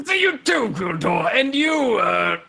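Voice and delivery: expansive, jovial greeting; big drawn-out warrior voice; hearty old champion. Acted. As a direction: welcome them like an old comrade.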